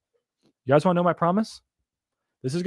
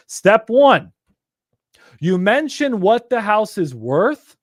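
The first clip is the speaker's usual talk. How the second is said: loud, slow step-by-step point; emphatic teaching; 4 semitones higher, 5 dB louder, much slower than usual. Real, not acted. Coach walks students through the pitch, loud and slow. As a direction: lay out step one deliberately. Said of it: "teaching the pitch steps".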